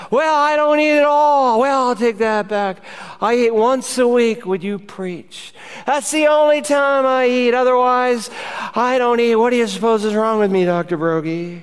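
high, put-on feeble whine; pitiful starving patient. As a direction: plead with the doctor like a hammed-up invalid. Acted starving patient answering doctor.

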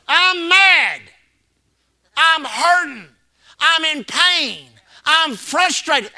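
hurting churchgoer. Retold or imitated.

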